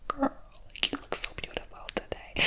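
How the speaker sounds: breathy conspiratorial whisper; whispered compliment. Retold or imitated. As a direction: lean in and whisper it like a secret. Secret compliment whispered, quoted.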